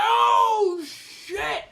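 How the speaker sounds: high-pitched shocked yell; hammed-up, high scream of shock. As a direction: shout at the screen in alarm. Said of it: "I'm yelling and screaming".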